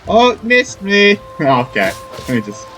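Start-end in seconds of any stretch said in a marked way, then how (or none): none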